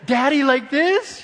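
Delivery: put-on high-pitched little boy asking Daddy; imagined young son. Father imagining son throwing the ball, high child voice. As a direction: lift it into an eager child's question. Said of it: "He's gonna say".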